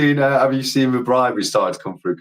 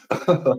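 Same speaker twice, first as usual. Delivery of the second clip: short, higher-pitched laugh; 5 semitones higher than usual. Real, not acted. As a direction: laugh at the cheeky bribe. Amused by the joking bribe.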